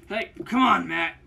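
ordinary delivery

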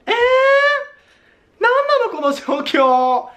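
high, very slow despairing whine; whiny lament. Real, not acted. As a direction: stretch it out in mock despair. Makeup looks bad in photos.

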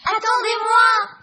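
high, loud shout to wait. Acted. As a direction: call out from far behind. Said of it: "crient les deux derniers".

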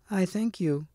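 high, soft hag voice; shrill cracked lady. A narrator's reading. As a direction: keep it cracked, high and hushed. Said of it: "in a shrill, cracked voice".

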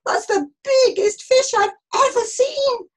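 high, loud cry of alarm; groaning dismay. A narrator's reading. Jonah facing a huge fish, alarmed wail. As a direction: wail it at the sight of a monster. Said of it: "Jonah groaned".

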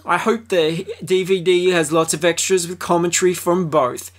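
high hammed-up fan voice; mock fan review read. Host reading a fan review, hammed up and high.

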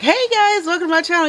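high, sing-out greeting of herself; mock channel greeting. Soapmaker imitating her own welcome to viewers, high-pitched mock greeting. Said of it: "So I'm just like".

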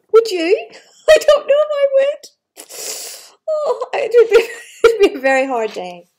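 loud, high laughing reply. Real, not acted. Reader asks the child and laughs, loud and high. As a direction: laugh through it.

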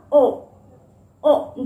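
ओ held long; a stretched ओ, not clipped; long oa sound. Shown as a demonstration. Teacher correcting how long ओ should be. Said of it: "थोड़ा ये long में रहेगा".

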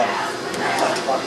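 I like to run said slow and whispered; whispered aside. Shown as a demonstration. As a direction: breathe I like to run as a sly aside.

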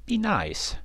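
very high motherly reprimand; high storybook scold. A narrator's reading. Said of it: "she would scold".

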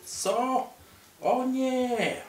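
shocked squeal; high alarmed cry. Pretend. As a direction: yelp as if caught out. Spy is discovered.

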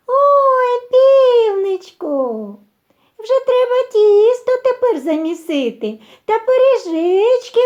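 very high, loud, gushing mouse voice; delighted little mice. A narrator's reading. Mice cheer the rooster and want pies, squealing delight. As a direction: squeal with joy, stretching the first words. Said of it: "пораділи".

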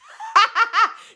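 high burst of real laughter; loud high cackle of laughter. Real, not acted. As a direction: let a big laugh out. Laughing at her hair.

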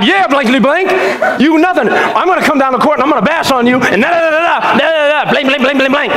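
high-pitched trash talk on the court; censored cussing rant. Acted. Preacher acting his old self cussing out basketball opponents, hammed-up trash talk. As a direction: rattle off mock curses, all fired up. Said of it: "I'm cussing all over the place".

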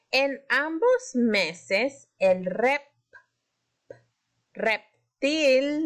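reptil drawn out, p stressed; stretched emphasis. Shown as a demonstration. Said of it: "levanten la mano si escuchan".

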